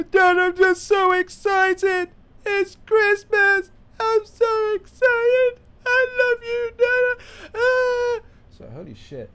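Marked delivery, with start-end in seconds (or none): 0.1-8.2 hammed-up crying child, very high and loud; imitating an overexcited kid sobbing; mock bawling. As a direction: wail it slowly through exaggerated happy tears. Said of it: "burst into tears as if".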